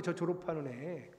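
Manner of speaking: ordinary delivery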